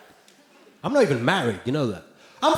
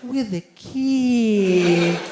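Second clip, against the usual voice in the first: [name] voice; 4 semitones higher, much slower than usual. Retold or imitated. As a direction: lightly mimic Michael's gentle voice. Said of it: "What's with that voice?".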